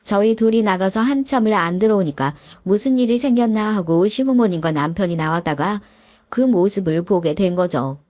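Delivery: ordinary talk, nothing marked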